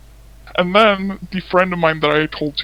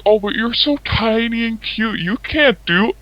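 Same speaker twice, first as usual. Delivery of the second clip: higher-pitched mock cooing at someone small; belittling, put-on higher voice; condescending mimicry; 7 semitones higher than usual. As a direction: imitate a patronizing creep, pitched up. Retelling the man mocking her.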